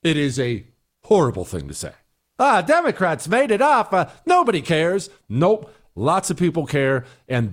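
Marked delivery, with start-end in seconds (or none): 2.4-5.1 high, scoffing brush-off of "Democrats"; mocking dismissive quote. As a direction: sneer it out like a lazy excuse. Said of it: "is a ridiculous answer".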